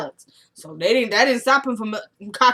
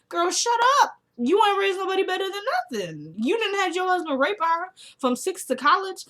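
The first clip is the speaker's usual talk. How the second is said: a much higher, indignant outburst; outraged scolding; 10 semitones higher than usual. Real, not acted. Vlogger scolding the mother on screen, high indignant outburst. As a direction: let the outrage jump up high.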